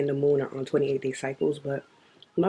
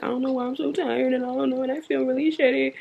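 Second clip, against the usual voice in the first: higher, faster mock-whiny complaint; whiny self-imitation; 7 semitones higher, faster than usual. Mocking herself before her period, whiny and fast. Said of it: "'cause I'm over there like".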